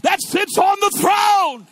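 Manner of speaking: loud, high, driving sermon shout; full-force preaching. Real, not acted. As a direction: proclaim God's majesty at full voice.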